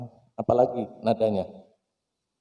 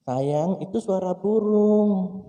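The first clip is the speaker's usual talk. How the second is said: tender father; 6 semitones higher, slower than usual. Acted. Loving father answers child.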